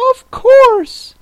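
very high-pitched creature voice; Mock Turtle. A narrator's reading. Mock Turtle explains the lobster quadrille to Alice, very high voice.

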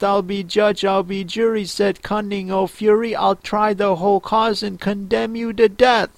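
high, even, singsong verse reading; poem recitation. A narrator's reading. The mouse recites its tale to Alice, singsong verse. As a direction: recite rhymed verse in a lifted, measured voice.

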